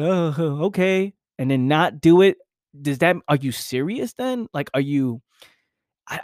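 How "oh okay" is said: dismissive laugh. Retold or imitated. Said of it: "And you're just like".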